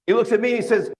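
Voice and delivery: ordinary talk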